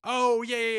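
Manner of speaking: high, loud burst of recognition; real excited "now I get it". Real, not acted. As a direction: exclaim it as it suddenly clicks. Sudden recognition of the film.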